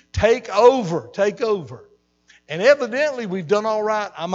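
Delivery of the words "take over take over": a sudden loud plea to God, then easing; pleading cry. Retold or imitated. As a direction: call out to God to take over. Pleading prayer to God retold.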